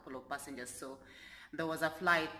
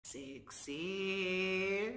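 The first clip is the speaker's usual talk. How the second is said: teasing sexy; 3 dB quieter than usual. Shown as a demonstration. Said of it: "I sound very sexy".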